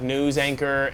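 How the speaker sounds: ordinary talk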